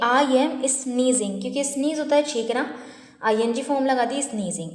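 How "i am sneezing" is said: very slow, each word spelled out for learners; slow model sentence. Shown as a demonstration. Teacher models the sentence for students, very slow and clear. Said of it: "तो मैं बोलूँगी".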